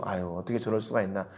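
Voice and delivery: soft, quick tut of disapproval, 수가 falling; disapproving sigh. Retold or imitated. People watching a bad leader on the news, quiet disapproving sigh. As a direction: mutter it with a resigned sigh. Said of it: "이렇게 막 구분이 되더라고요".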